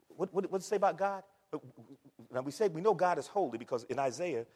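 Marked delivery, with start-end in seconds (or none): none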